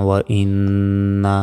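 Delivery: ওয়া ইন্না with a lingering nasal nn; slow down on ইন্না, holding its n; obligatory ghunna. Shown as a demonstration. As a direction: hum the doubled n in ইন্না. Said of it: "ওয়াজিব গুণনা হয়".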